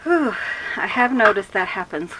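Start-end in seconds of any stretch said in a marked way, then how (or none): 0.0-0.3 high puffed-out exhale; breathless whew. Real, not acted. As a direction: blow out a winded whew. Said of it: "I'm kinda out of breath".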